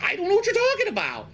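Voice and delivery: mock innocent kid. Boy protests innocence to his teacher, high pitched. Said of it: "I was like".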